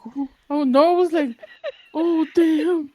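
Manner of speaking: high, loud dismayed cry; a loud, high, alarmed exclamation; mock panic. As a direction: sound caught off guard. Narrator panicking in full gym.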